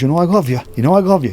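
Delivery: lightly voiced big-hearted coach; Don Reinhardt voice. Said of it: "That's how he talks".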